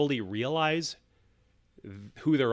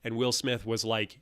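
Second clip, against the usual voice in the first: normal talking voice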